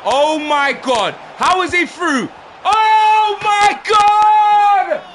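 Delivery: slow, drawn-out high yell; real exasperated outcry; disbelieving shout. Real, not acted. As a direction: howl in horror as he goes through. Opponent through on goal, shouting.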